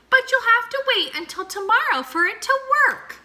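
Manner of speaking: high, fast squeaky mouse voice. A narrator's reading. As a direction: chirp it out quick like a tiny mouse.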